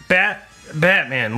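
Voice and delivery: ordinary talk, nothing marked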